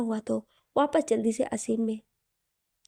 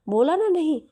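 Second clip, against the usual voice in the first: raised, sharp no; firm loud refusal; 5 semitones higher, 6 dB louder than usual. Acted. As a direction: push him off with a loud no. Hina refusing firmly.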